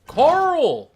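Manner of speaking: exasperated shout. Acted. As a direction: shout the name, high and loud.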